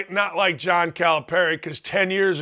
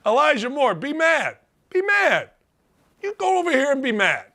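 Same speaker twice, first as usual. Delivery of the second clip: mocking taunt; 6 semitones higher than usual.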